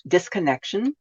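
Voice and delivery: normal talking voice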